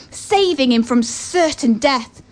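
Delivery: usual speaking voice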